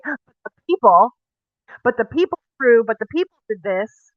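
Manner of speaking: hammed-up blame-shifting plea; Aaron's excuse. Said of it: "he's gonna say".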